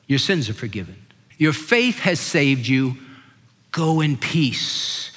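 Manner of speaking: low, soft and slow, every word weighed; solemn scripture reading. Acted. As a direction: read it like a blessing spoken over someone. Quotes Jesus' words, solemn reading.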